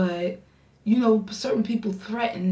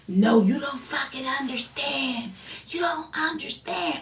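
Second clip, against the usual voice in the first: high-pitched imitation of a frantic caller; mock screaming; 6 semitones higher than usual. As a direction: wail it in his overwrought, screaming voice. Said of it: "he just started screaming at me".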